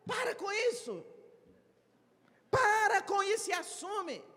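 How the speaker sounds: firm scolding building to a shout. Acted. Speaker scolding excuse-maker to take responsibility, rising shout. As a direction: cut them off, then shout the order.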